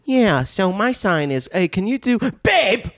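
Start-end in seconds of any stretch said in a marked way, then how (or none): 1.5-2.9 put-on teasing boyfriend voice. Acting out a boyfriend at home.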